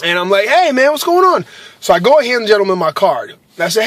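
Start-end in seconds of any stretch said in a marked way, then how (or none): 0.5-1.4 higher, upbeat greeting; high friendly greeting. Retold or imitated. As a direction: greet him warmly and high. Retold greeting to stranger.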